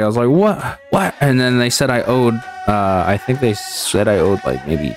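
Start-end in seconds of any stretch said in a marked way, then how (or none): 0.3-1.1 high, angry "what"; a sharp, disbelieving double take, pitched up; angry disbelief. Retold or imitated. Electricity bill too high, angry disbelieving outburst. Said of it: "And I was just angry".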